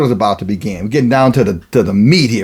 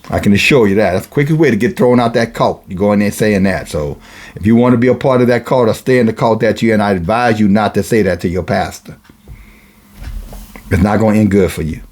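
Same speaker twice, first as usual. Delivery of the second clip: ordinary talk, nothing marked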